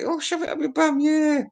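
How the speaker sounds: high, soft squeaky praise; mock fanboy squeal; high mock gush. High mock rave over track.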